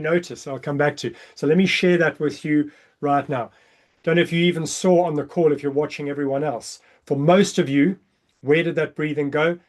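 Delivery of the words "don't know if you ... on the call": normal talking voice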